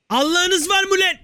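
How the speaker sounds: high-pitched playful angry yell; mock outrage. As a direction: cry out in indignation at a rival. Yells at rival shop while attacking. (tiz sesle şakacı öfke)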